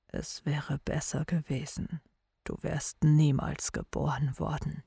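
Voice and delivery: low, soft father voice; quiet, low father voice. Acted. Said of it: "Sein Vater sprach so leise".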